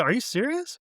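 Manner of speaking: retold high, rising question of disbelief; incredulous. Acting own disbelief at David's answer.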